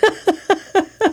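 loud high burst of laughter. Real, not acted. Laughing along with the co-host.

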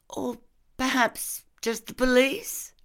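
high, tentative question; slightly hesitant. Acted. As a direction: suggest it hesitantly, pitched well up. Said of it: "She hesitated slightly and then said".

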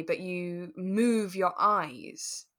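ordinary talk, nothing marked

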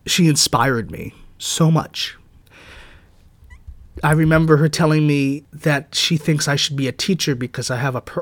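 ordinary talk, nothing marked

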